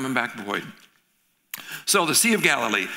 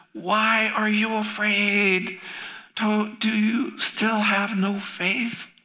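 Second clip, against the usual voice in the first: slow, higher wondering voice; gentle questioning; 8 semitones higher, much slower than usual. Acted. Jesus asking the frightened disciples, slow and gentle.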